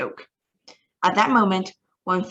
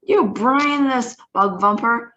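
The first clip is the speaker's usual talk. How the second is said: slow, louder, furious insult; slow furious rant; 4 semitones higher, 6 dB louder, slower than usual. A narrator's reading. Grand High Witch berates the foolish witch, slow and louder. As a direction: spit it out slowly in rage. Continuing tirade.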